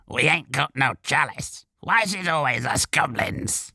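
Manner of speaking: a wronged goblin grumbling from the throat; throaty goblin. A narrator's reading. The goblin denies stealing the chalice, aggrieved rasp.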